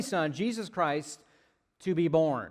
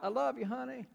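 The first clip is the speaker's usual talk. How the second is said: mock holler; 7 semitones higher, 7 dB quieter than usual. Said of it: "holler out".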